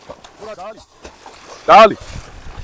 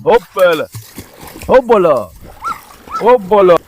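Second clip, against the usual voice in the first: punchy, louder cheer on each heave; playful hoopla; 3 dB louder than usual. Real, not acted. Owner getting his dog moving to the beach, playful hoopla. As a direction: call it out like a lift-and-go cue.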